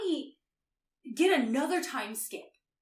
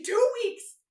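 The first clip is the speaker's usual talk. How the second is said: high, loud mock outrage; exasperated disbelief; 6 semitones higher, 6 dB louder than usual. Chase reacts to the two-week delay, exasperated disbelief. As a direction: imitate someone incredulous and fed up.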